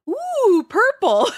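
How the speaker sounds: high and loud, totally sure of herself; convinced exclamation. Retold or imitated. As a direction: announce the wrong color with full conviction. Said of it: "I said it with such conviction".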